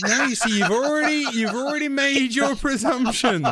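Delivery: high, loud indignant protest; fired up. Real, not acted. Host rebutting co-host's teasing accusation, fired up. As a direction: cut in loudly, voice pitched right up. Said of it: "you were all fired up and".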